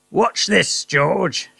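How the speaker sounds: high gleeful shout as Mister Cranky; excited husband showing off. Acted. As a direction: crow it out like a giddy old man. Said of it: "Mister Cranky shouted".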